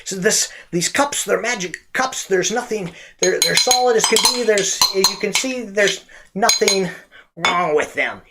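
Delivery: high-pitched sales patter; magic shop pitchman. Acted. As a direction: play the magician pitching his trick. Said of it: "the guy goes".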